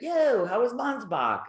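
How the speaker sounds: casual greeting. Retold or imitated. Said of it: "I'd be like".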